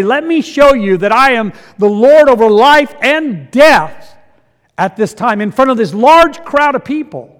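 loud, rising sermon climax, slowed on key lines; preaching at full force. Acted. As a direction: drive it home to the congregation. Preaching the miracle's timing.

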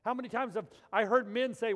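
loud, high preacher's cry; full-force preaching. Acted. Preacher calling out to the men, loud and high. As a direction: preach it out hard to the congregation.

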